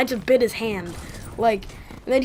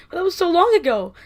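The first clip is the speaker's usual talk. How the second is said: whining protest; 7 semitones higher, 5 dB louder than usual. Retold or imitated. Retold protest to dad.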